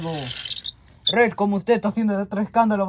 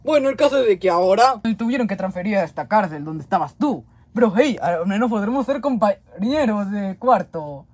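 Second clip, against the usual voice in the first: normal talking voice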